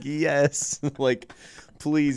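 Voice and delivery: high, pleading mock eagerness; lightly hammed-up relief; eager yes. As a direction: beg like a desperate kid. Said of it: "we're just like".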